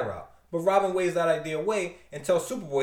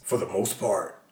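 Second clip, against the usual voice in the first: low, slow deadpan; dry narrator; 8 semitones lower than usual. Acted. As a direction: deliver it deadpan. Final caption read.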